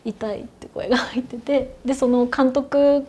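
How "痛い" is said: put-on faint, soft little-girl cry of pain; a hint of a small child whimpering it; little girl's voice. Recalling ghostly footage, a faint mock little-girl cry. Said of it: "結構幼い女の子の声だったんですけど".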